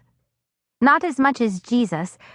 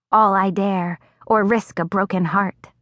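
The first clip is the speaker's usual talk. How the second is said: ordinary delivery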